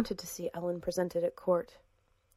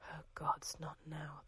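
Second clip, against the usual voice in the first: much softer, breathy inner whisper; whispered thought; 3 semitones lower, 15 dB quieter than usual. A narrator's reading. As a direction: whisper it to yourself in dismay. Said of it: "thought Sylvan".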